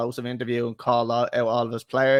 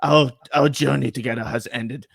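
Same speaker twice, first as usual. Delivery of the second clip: mock Italian accent, mostly lower-pitched; Antonio Conte accent; 3 semitones lower than usual. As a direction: sign off like an Italian coach saying farewell. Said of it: "can you just do the accent?".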